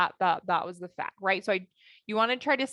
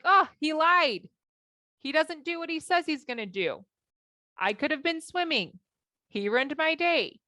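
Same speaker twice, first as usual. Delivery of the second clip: dramatize the wronged inner voice; frustrated; 6 semitones higher than usual. Retold or imitated. Wife replaying her thoughts about her husband, frustrated. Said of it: "Why do I feel frustrated?".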